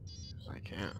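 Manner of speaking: breathy whisper, no voice. Acted. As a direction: whisper it to yourself. Repeats line, now whispered.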